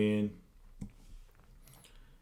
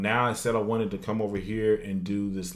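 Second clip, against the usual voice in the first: ordinary delivery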